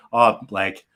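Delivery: ordinary talk, nothing marked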